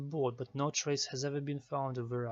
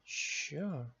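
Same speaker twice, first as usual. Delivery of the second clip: normal talking voice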